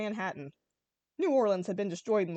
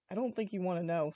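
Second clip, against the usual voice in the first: ordinary delivery, nothing marked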